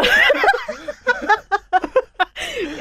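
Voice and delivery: long high-pitched laugh. Real, not acted. Joking about replying privately to the co-host, long high laugh. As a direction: burst into a long, high giggle.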